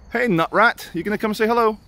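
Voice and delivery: high, sing-song call to a squirrel; high pet-calling voice; coaxing an animal. Real, not acted. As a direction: coax a small creature over, pitch climbing. Calling a squirrel to come over.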